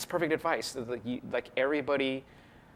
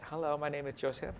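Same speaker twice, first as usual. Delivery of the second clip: lightly raised put-on voice, not his own; put-on stage voice; 3 semitones higher, 8 dB quieter than usual. Shown as a demonstration. Opening his magic show to the audience, lightly put-on voice. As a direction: greet them as a mock stage character. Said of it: "doing that bit".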